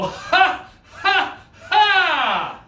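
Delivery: high, loud "ha" calls, last drawn out; mock laughter. Shown as a demonstration. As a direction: belt out big pretend laughs. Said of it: "everyone go".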